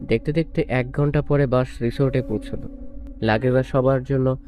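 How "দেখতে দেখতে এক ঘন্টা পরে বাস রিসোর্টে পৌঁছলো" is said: usual speaking voice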